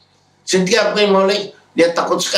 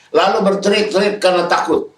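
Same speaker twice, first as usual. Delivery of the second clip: ordinary delivery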